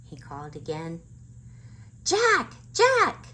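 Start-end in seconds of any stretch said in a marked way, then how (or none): 2.0-3.3 very high, loud urgent calls; shouting. A narrator's reading. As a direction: scream his name to get him over. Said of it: "Annie shouted".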